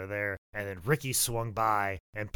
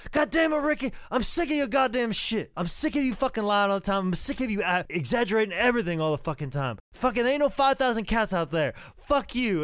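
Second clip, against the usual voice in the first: high-pitched exasperated rant; Bobby Eaton; 12 semitones higher, 6 dB louder than usual. Retold or imitated. Bobby fed up with his partner's exaggerating, ranting. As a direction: blow up in a mock tirade. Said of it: "Bob was like".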